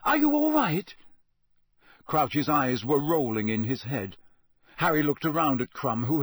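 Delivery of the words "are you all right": much higher, louder urgent question; boy shouting to a collapsed man; alarmed. A narrator's reading. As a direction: check on him in alarm.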